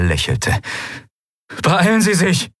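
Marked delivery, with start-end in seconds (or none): 1.6-2.5 high, strained hurry-up; urgent call. A narrator's reading. Kai hurrying the admiral along, high urgent call. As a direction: hurry them through the gap. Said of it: "rief er".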